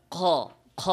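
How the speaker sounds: usual speaking voice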